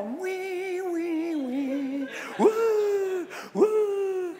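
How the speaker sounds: high mock keening; drawn-out fake sobbing wail; imitated wailing. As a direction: wail like hired mourners, long and high. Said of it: "professional wailers".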